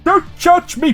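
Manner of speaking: normal talking voice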